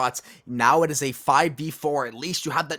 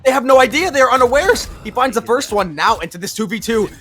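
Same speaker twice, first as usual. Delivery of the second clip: higher and louder hype call; esports caster; 8 semitones higher, 8 dB louder than usual. Acted. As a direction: push the excitement up. Clutch call from partner.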